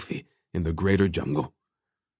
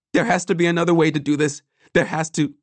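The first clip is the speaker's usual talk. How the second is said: high, rushed protest; desperate pleading; 8 semitones higher, 3 dB louder, faster than usual. A narrator's reading. Boy begs for another way, desperate. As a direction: plead fast with the voice pitched right up.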